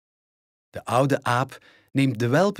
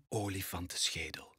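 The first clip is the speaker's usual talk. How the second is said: fluister het woord spannend; dramatic whisper; 6 semitones lower, 14 dB quieter than usual. A narrator's reading. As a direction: laat de onthulling zacht en geheimzinnig klinken. Dramatic reveal in story. (fluisterend, spannend onthuld)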